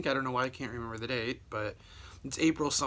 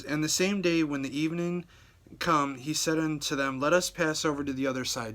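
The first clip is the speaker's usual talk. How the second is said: a slightly raised, formal Bible reading; measured King James reading; scripture reading; 4 dB louder than usual. Acted. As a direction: read the verse out to the congregation. Reads scripture passage aloud.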